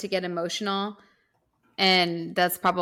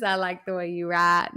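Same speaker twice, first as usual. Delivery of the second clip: lightly imitated Southern accent; thick Southern accent. Retelling a fan meeting George R R Martin, Southern accent. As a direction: put on a Southern twang for the quote. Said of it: "has a thick Southern accent".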